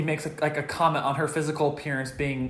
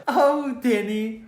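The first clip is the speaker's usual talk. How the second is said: lilting, higher, breezy dismissal; nonchalant brush-off; 5 semitones higher, much slower than usual. Retold or imitated. Friend imitating her blowing off Denny, totally nonchalant. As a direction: brush him off lightly. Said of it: "like, totally nonchalant".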